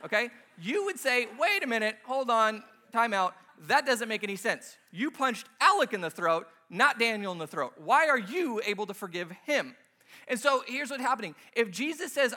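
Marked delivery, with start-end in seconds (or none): 1.4-9.7 louder, protesting in disbelief; indignant objection. Acted. Listener objecting to Cody being forgiven, indignant protest. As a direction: stop them and object emphatically.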